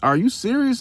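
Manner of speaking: high-pitched imitated what, are you serious; mock disbelief. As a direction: mimic the coworker's shocked outrage. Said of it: "he was like".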